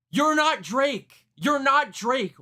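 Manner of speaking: high, drawn-out protest; exasperated, high-pitched outburst. Real, not acted. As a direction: stretch it out loud in disbelief. Exasperated rant at the rapper's accent.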